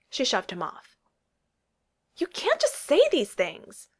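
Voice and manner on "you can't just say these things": upset. Acted. She shoves him off, upset.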